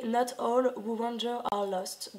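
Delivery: English quote read with a French accent; real French accent on English words. Real, not acted. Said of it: "pardonnez mon accent anglais".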